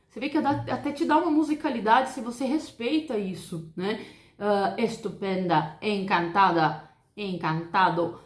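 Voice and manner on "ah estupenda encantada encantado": estupenda, encantada said slowly with closed e; slow Spanish demo words. Shown as a demonstration. Teacher models closed Spanish e slowly. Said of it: "La E en español é E mesmo".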